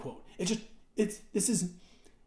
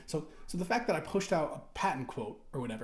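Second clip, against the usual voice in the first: ordinary delivery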